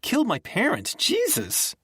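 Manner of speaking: high, tearful outburst; angry sobbing shout; furious and crying. A narrator's reading. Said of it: "She was still crying, but she was also furious".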